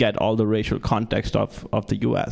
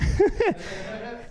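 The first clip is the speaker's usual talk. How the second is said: high breathy giggle; 4 dB quieter than usual. Real, not acted. Laughs at own joke.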